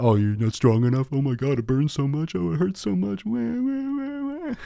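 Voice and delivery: mock a weak lifter crying like a baby; mock crybaby whine. Mocking a weak lifter's whining.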